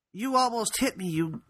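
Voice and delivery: high-pitched mock outrage; indignant grandmother. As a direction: imitate an indignant grandma telling someone off. Grandmother confronts rude driver.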